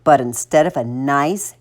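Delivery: usual speaking voice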